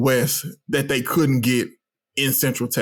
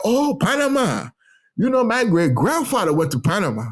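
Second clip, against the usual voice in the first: delighted recognition; 7 semitones higher than usual. Retold or imitated. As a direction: imitate strangers discovering a shared link. Acting out Panamanians' delighted recognition.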